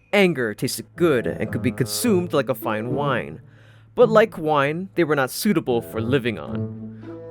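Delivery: usual speaking voice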